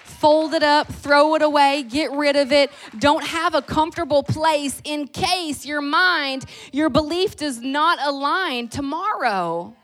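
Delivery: loud, high, driving sermon; slow, pounding pulpit shout; fiery preaching. Acted. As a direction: preach it hard to the congregation. Preaching at full force.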